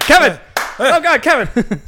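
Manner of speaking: yelling a name in mock alarm; hammed-up cry for Kevin; calling out loud.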